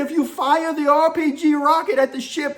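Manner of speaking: high, loud scolding of a pirate; imitated chief mate shouting down; retold yell. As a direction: holler it down from the ship, reenacting. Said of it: "I yelled down to him".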